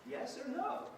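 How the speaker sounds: imitating the teacher quizzing a child, high and fast; teacher's question. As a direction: pepper her with a cheery yes-or-no question. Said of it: "she would look at me and be like".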